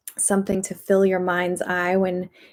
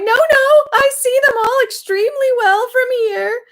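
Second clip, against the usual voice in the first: much higher, panicked wail; weeping in despair; 5 dB louder than usual. A narrator's reading. Terrified dentist refusing the crocodile, high wail. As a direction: plead desperately in a high cry. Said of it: "weeping in despair, he cried".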